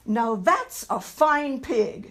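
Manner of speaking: very slow, savoring appraisal; lightly voiced Ted, slow and pleased; Ted's pig remark. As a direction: draw it out like an admiring farmer. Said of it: "And Ted looks over and goes".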